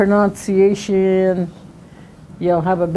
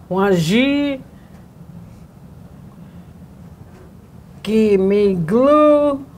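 syllables pitched higher and marked, slow; stress marks shown; 5 semitones higher, much slower than usual. Shown as a demonstration. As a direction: lift each stressed syllable for the class. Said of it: "See how those stress marks work?".

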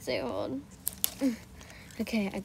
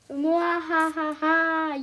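mad scientist; 5 semitones higher, 3 dB louder, much slower than usual. Pretend. Gloating after victory.

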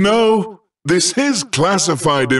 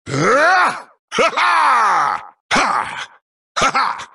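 short high-pitched game character cries; quick triumphant-style vocal bursts; high exclamations; 11 semitones higher than usual. Acted. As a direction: let out sudden high "ha" shouts. Series of game exertion cries.

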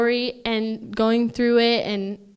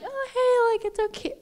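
much higher, fake-casual sing-song; mock innocent parents; 12 semitones higher than usual. Imitating mom and Ernie hiding news, high and falsely casual. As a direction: play the parents hiding a secret, too breezy. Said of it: "they were acting really weird".

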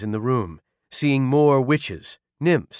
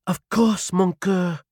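voiced woman character; 8 semitones higher, slower than usual. A narrator's reading. Naomi consenting to her wounded vampire, high feminine voice. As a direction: answer a lover in a high, airy voice.

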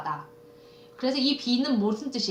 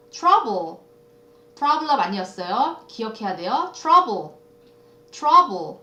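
ordinary talk

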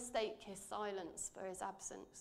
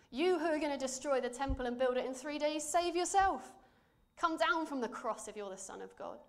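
a hint of a jeering passerby, louder; mocking insults; 5 semitones higher, 6 dB louder than usual. Passerby taunting the crucified man, a mild mocking jeer. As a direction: jeer up at the cross, taunting lightly. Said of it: "hurled insults at him".

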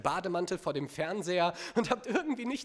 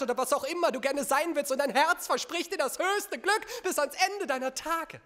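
gushing rapture; 7 semitones higher than usual. Acted. Gushing lovestruck promise, performed.

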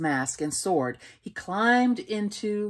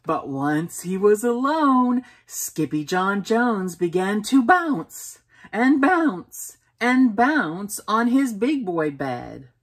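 ordinary delivery

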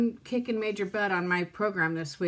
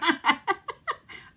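high rising laugh through tears; teary laugh; 7 semitones higher than usual. Real, not acted. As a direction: laugh at herself while crying. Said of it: "cry on camera".

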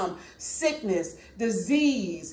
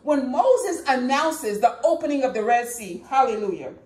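usual speaking voice

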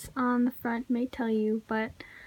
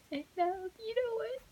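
choking up, fighting back crying; tearful break in the voice; on the verge of tears; 8 semitones higher, 4 dB quieter than usual. Real, not acted. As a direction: voice cracking high with real tears. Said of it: "No, I'm gonna cry".

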